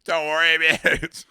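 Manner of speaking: hammed-up dismissive excuse; Australian excuse-maker. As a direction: wave it away like a defensive bloke. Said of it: "this is the Australian argument".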